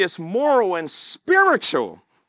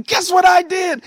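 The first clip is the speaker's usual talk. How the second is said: higher, louder eager call; excited announcement; 12 semitones higher, 6 dB louder than usual. Retold or imitated. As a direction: burst out the news to the family. Calling relatives after salvation.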